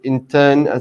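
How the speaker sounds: normal talking voice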